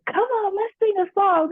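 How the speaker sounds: high, excited coaxing voice; brother's big voice. Retold or imitated. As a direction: call her in like a big-personality brother. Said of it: "he would be like".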